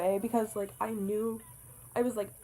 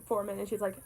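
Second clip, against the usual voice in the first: usual speaking voice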